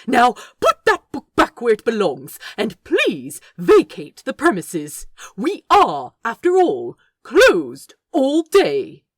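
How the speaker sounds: loud, stern order, slowing at the end; fed-up command. Acted. The angel orders the orangutan out, loud and stern. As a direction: order the intruder out, losing patience. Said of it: "Aziraphale had had enough".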